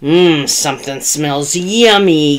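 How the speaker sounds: hungry bear's growl. A narrator's reading. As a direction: growl it, savouring the smell. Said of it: "growled the bear".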